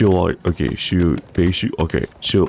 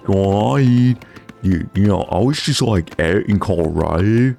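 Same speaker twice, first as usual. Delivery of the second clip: surfer bro voice; 3 semitones higher than usual. Shown as a demonstration. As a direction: ramble like a stoked surfer bro. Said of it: "Pretend you're waiting for an elevator".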